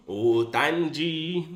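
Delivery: ordinary delivery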